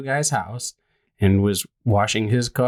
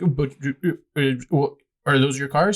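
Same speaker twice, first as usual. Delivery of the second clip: higher, stumbling comeback; retold flustered stammer; 4 semitones higher than usual. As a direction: imitate a busybody fumbling for an objection. Retold flustered complainer losing argument.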